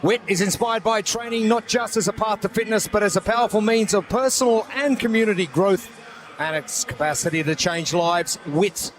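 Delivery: smooth sponsor read. Acted. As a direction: sell it like a commercial.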